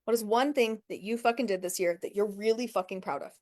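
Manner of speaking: ordinary talk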